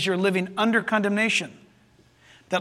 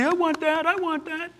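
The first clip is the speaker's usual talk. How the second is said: higher, softer put-on voice; small, high voice of someone wanting it; eager listener; 6 semitones higher, 6 dB quieter than usual. Preacher voices a listener answering him, eager high voice.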